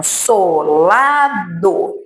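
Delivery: "solado" in three stretched beats; separado em sílabas. Shown as a demonstration. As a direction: lay out so, la, do slowly in "solado". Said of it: "aqui eu tenho". ("solado" devagar, marcando cada sílaba)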